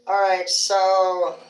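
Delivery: usual speaking voice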